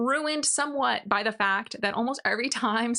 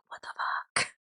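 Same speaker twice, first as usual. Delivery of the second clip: crowd whisper; 6 dB quieter than usual. Retold or imitated. Crowd's stunned reaction.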